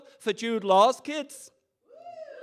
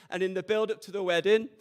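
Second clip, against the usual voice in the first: ordinary talk, nothing marked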